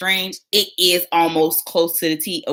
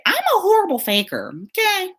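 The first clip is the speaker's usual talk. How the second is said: pitched way up in mock shame; high squeaky confession. Real, not acted. Nurse admitting she can't fake, high squeal.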